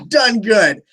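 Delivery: chuckling through the words; laughing. Real, not acted. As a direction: laugh as you say it. Laughing while reading comment.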